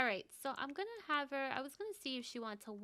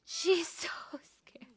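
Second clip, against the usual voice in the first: unvoiced scared whisper; 7 semitones higher, 5 dB louder, slower than usual. Real, not acted. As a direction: breathe it like you're frightened. Narrating the scared character.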